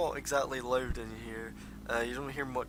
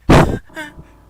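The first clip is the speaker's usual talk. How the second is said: loud, high gasp on waking; startled gasp; 25 dB louder than usual. Acted. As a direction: gasp as if jolted awake. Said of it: "he wakes up startled, like".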